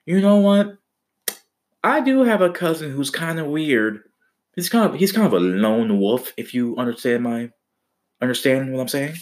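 high, put-on voice for the cousin; a high-pitched cousin hinting at a weird relative; cousin's voice. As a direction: play the cousin as a sly oddball. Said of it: "the cousin was like".

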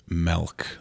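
"Melk" drawn out with a short E; Minnesota accent. Shown as a demonstration. Demonstrating the word milk, Minnesota accent. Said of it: "Minnesota ones are I's and E's".

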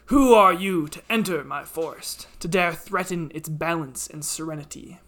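booming, angry ancient tree; loud, wrathful forest spirit; talking tree. Acted. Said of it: "the tree speaks".

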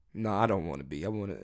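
low, soft comic backtrack; sheepish admission. Acted. Comic taking back his claim about being alone, sheepish. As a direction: drop the bravado, go small and quiet. Drops the act and admits it.

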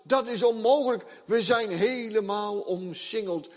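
high, loud, panicked pleading; desperate servant. Acted. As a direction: plead in terror, seeing no way out. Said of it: "Je hoort de nood uit zijn woorden".

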